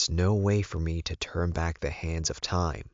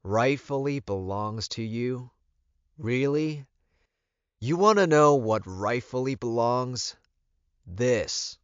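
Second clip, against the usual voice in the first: louder, higher, scornful retort; fired in anger; 6 semitones higher, 6 dB louder than usual. A narrator's reading. Alpha firing back angrily at Elizabeth. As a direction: fire the words back at her. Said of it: "I fired in anger".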